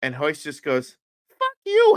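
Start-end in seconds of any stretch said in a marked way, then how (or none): 1.3-2.0 much higher, louder outburst; retold angry retort. Coach retorting to the man making fun of him, mock outburst. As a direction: fire back, imitating an insulted coach.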